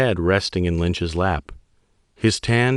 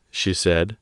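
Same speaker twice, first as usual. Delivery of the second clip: ordinary delivery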